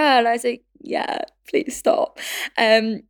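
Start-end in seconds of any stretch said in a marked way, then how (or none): 0.8-2.2 slow, whispered retold plea; weary whispered plea. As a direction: drag it out under your breath. Retells her uneasy reply to worker.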